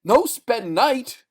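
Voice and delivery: high, loud, slow panicked plea; broken terrified plea. Acted. Terrified man refusing the night, panicked plea. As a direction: beg in broken words. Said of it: "face melting with terror".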